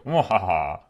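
hammed-up evil cackle; mock villain laugh. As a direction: gloat like a cartoon villain. Gloating at the winning attack.

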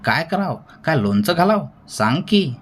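high, testy string of questions; snapping sarcastically. A narrator's reading. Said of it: "Patil मध्येच खेकसला".

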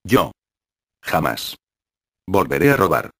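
Yo, Jamás, Volveré a robar, each word weighed with pauses between; a hint of tears, each word set apart; slow, every word stressed. A narrator's reading. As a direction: hold back tears, pressing each word slowly. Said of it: "despacio, recalcando cada palabra, conteniendo difícilmente las lágrimas".

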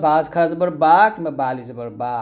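both final বা sounds held equally long; identical madd stretch. Shown as a demonstration. Teacher shows two madds are equal, each বা drawn long. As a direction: stretch each বা after জবর the same way. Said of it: "একইভাবে উচ্চারণ করতে হবে".

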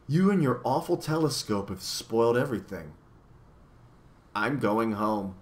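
usual speaking voice

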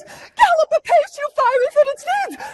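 very high, loud, urgent cry; breathlessly eager girl urging horses on at full pitch; impatient Juliet. Acted. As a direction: burst out, stressing the GAL of "Gallop". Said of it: "She cannot wait for Romeo".

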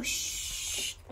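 soft unvoiced shush; whispered shush. Real, not acted. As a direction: hush them gently. Hushing, baby sleeps.

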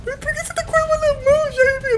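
squeaky mocking falsetto; mock the sheriff in a high voice. (voz fina debochada)

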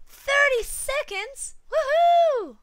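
high, loud squeal of joy; high, loud thrilled whoop; excited cheer. Acted. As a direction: shriek with delight. Thrilled at video length.

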